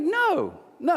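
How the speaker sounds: high-pitched mock-incredulous no; acted refusal. Retelling his answer to a neighbour wanting his things, high-pitched refusal. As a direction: shoot the pitch right up to refuse.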